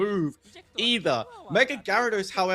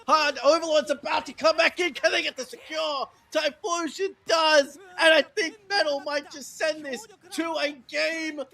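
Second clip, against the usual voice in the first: high, loud excited esports shout; live play-by-play; 12 semitones higher, 3 dB louder than usual. Acted. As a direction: call the fight at full hype.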